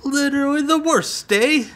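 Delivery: put-on a jokey, high Italian accent; a hammed-up Italian accent on the insult. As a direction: throw in a mock Italian accent with an "eh". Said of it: "That's, that's my Italian".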